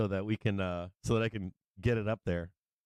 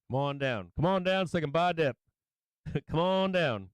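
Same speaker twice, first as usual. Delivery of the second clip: higher, hammed-up "come on down" host chant; game-show hype call; 6 semitones higher than usual. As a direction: play a cheesy TV game-show announcer, half laughing. Mock game-show call to viewers.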